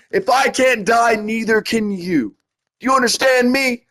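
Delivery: impassioned plea. Retold or imitated. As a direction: beg him to live, voice climbing. Emotional plea to friend.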